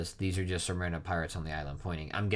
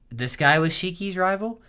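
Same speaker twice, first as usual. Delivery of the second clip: surprised; 10 semitones higher, 6 dB louder than usual. Real, not acted. Reacting to surprising reveal.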